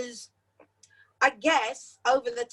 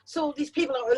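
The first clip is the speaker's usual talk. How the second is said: ordinary talk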